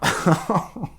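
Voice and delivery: short burst of laughter; real chuckle. Real, not acted. Teller announcing the next fairy tale, chuckling. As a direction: chuckle to yourself.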